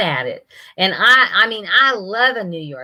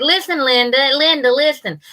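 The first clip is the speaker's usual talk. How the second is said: higher, mock telling-off; retold scolding to grandson; 7 semitones higher than usual. Grandma getting on to her grandson, playful retold scolding. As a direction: imitate yourself getting on to him. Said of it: "I said".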